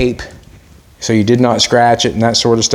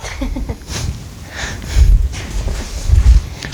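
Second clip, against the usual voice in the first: long wheezy giggle with no voice; airless giggle; 12 semitones higher than usual. Real, not acted. Owner laughs at his dog, silent wheezing giggle.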